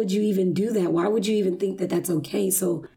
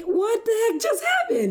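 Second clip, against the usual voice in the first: high, incredulous what-the-heck; a wife's high, stunned question to her husband; stunned disbelief; 14 semitones higher than usual. Retold or imitated. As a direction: squeak it out in shock.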